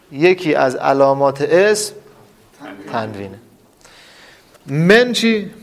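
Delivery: usual speaking voice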